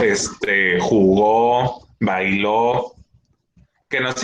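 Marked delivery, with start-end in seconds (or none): 0.8-1.9 "jugó" with the final o stressed and held; stress on final syllable. Shown as a demonstration. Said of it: "terminan con O llevan acento". 2.0-2.9 "bailó" with the final o stressed and held; stress on final syllable. Shown as a demonstration. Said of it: "terminan con O llevan acento".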